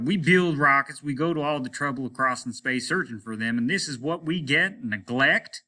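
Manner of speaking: captain railing at indifferent locals; exasperated captain. Acted. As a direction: vent your frustration. Captain rants.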